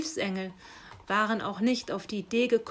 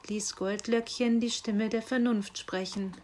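usual speaking voice

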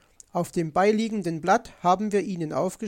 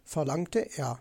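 ordinary delivery, nothing marked

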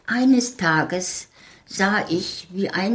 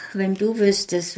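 usual speaking voice

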